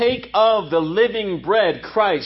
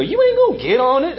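higher-pitched doubting dad; dad impression; 5 semitones higher than usual. Preacher mimicking dad on buying a treadmill, raised-pitch disbelief. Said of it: "That's how my dad would talk".

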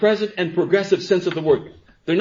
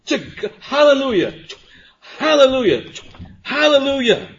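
joyful hallelujah; 10 semitones higher, 5 dB louder than usual. Real, not acted. Joyful praise climax in sermon.

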